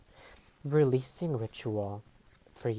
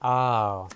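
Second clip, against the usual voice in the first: surprised gasp; 8 dB louder than usual. Real, not acted. A card jumps out.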